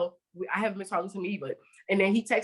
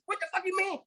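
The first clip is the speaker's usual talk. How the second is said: mock angry wife; 10 semitones higher, 4 dB quieter, faster than usual. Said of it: "the wife started going off".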